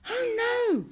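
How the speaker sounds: high, loud alarmed gasp of words; a little dog realizing time is short; dismayed cry. A narrator's reading. Dog realizing he is late, alarmed high cry. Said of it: "thought the little dog".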